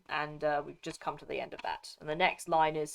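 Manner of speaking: ordinary delivery, nothing marked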